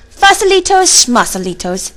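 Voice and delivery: high, loud first word, then dropping down; mocking singsong brush-off; dismissive declaration. A narrator's reading. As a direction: scoff at the word. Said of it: "declared Poquito Tito".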